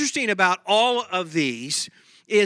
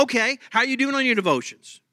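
ordinary talk, nothing marked

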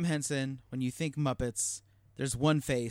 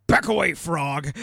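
Miss Piggy threat; 9 dB louder than usual. Retold or imitated. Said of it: "she's like".

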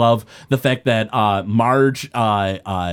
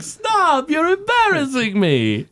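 much higher, bashful goofy doctor; a silly high fake-modest doctor voice; Dr Nick's voice; slower than usual. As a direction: play it coy and flattered. Dr Nick's bashful reply, retold.

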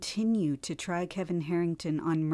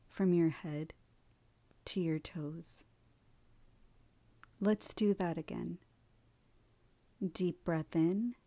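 guided meditation. Acted. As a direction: lead them gently into stillness.

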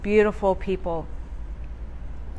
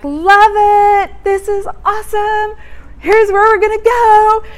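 much higher, louder gushing voice; excited house hunter; 10 semitones higher, 6 dB louder than usual. Retold or imitated. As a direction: gush over the new house. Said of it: "I'm like".

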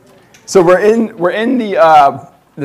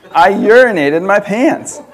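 laughing through it, slow and louder; laughing repeat; 5 semitones higher, 6 dB louder, slower than usual. Retold or imitated. Rereading the mom's autocorrect text, laughing slowly. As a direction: savour the absurd line with a laugh.

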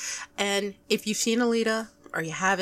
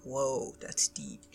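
low, slow and hushed; awed aside; 7 semitones lower, 8 dB quieter, slower than usual. Retold or imitated. Fan recalls learning a character's backstory, awed and hushed. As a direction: drop it low, as if awestruck.

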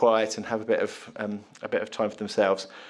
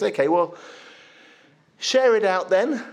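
higher, louder insistent order; 7 semitones higher, 3 dB louder than usual. Acted. Jesus insisting the disciples share out the five loaves. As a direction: press them to just do it.

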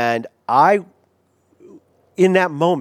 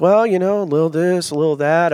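higher, evasive sing-along hedging; unsure; 4 semitones higher than usual. Retold or imitated. Pastor dodging the prophet's question on the phone, unsure. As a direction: dodge the question with a noncommittal lilt. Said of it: "I was unsure".

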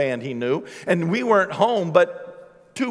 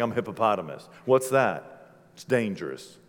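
low, soft hippo voice; hushed proud hippopotamus, pitched down low; hippo talking; 9 semitones lower, 6 dB quieter than usual. Pretend. Hippo boasting it is dangerous, low and quiet. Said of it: "it's like".

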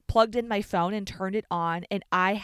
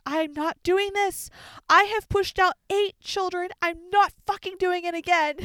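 sobbing refusal; 12 semitones higher, 7 dB louder than usual. Retold or imitated. Mom in labor refusing to push, high sobbing wail. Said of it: "Mom just started sobbing".

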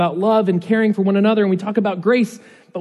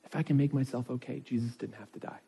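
repeated much softer, almost under the breath; 6 semitones lower, 14 dB quieter than usual. Shown as a demonstration. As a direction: let it sink in, drop the volume on the repeat. Repeats key point, dropping softer.

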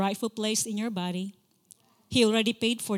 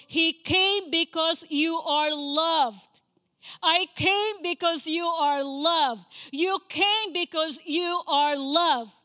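fervent preaching; 4 semitones higher, 4 dB louder than usual. Acted. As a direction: drive each line home to the church. Preaching at full force.

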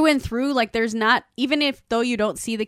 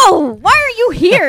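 high, loud mock outrage; imitating an indignant woman; 9 semitones higher, 10 dB louder than usual. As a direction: play the startled homeowner, higher and louder. Retelling TV scene, acted quote.

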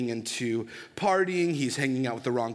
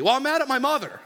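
put-on higher, louder teenage outburst; the son tells the pastor who he is mad at, in a higher, louder outburst; angry teen; 10 semitones higher, 5 dB louder, faster than usual. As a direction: blurt it out like a fed-up teen. Teen blurts anger.

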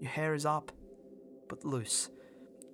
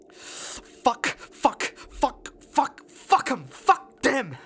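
explosive curses building louder; furious swearing; 7 semitones higher, 7 dB louder, much slower than usual. Acted. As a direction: spit out each curse, building to a loud high peak. Swearing outburst after being sacked.

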